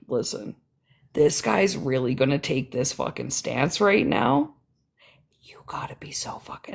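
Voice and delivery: hushed disbelief, dropping to a whisper; stunned whisper. Retold or imitated. To herself over his stance, a stunned whisper. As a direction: whisper it like you can't believe it. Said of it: "I'm like".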